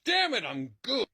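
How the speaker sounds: ordinary talk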